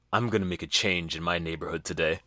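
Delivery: deadpan low vow, quiet and heavy; hushed mock-noble declaration; mock earnest resolve. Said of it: "just hear and go".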